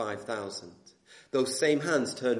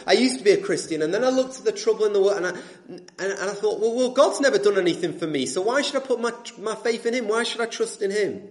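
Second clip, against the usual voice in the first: quoted grumble, higher, louder and insistent; aggrieved complaint; 7 semitones higher, 5 dB louder, faster than usual. Acted. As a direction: voice a disillusioned ex-believer's protest.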